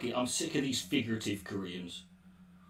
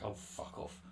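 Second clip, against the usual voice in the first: much softer whispered brush-off; whispered aside; 15 dB quieter than usual. Real, not acted. Answering a question about CPAC, a soft whispered brush-off. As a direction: whisper it under your breath.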